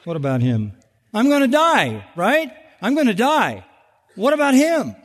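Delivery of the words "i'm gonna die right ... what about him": whiny Peter. Retold or imitated. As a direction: whine like a jealous disciple. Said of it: "Peter is a constant project".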